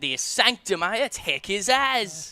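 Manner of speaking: broad Aussie twang, hammed-up; Australian accent. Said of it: "this is too Australian".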